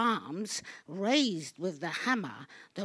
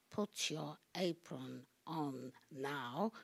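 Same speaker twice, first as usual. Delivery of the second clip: hushed coaxing; 5 semitones lower, 9 dB quieter than usual. Acted. Coaxing the statue.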